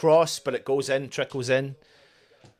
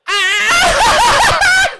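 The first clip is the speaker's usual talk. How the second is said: very high, loud goal scream; celebratory scream; 9 dB louder than usual. Real, not acted. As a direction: scream wildly as the goal goes in. Celebrating West Ham's goal live.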